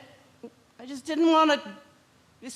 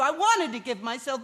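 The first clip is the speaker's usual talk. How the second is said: ordinary talk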